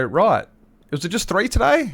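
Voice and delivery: high-pitched retold checking question; lightly acted customer query; imitated regular customer.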